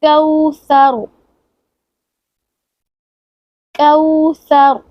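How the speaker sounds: कौसर chanted slowly, lips rounded on the heavy र. Shown as a demonstration. Teacher recites कौसर slowly, mouth rounded. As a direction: draw out कौसर with a full, round र. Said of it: "गोल कर लेंगे".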